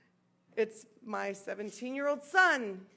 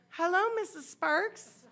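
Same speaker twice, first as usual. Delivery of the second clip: higher, singsong polite greeting; imitated teenage girl; 6 semitones higher than usual. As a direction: mimic a girl greeting her boyfriend's mother.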